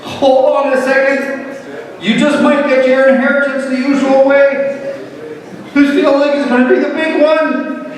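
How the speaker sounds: high, hammed-up dying father act; theatrical fake heart attack. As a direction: overplay clutching your heart for effect. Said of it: "Skip the theatrics".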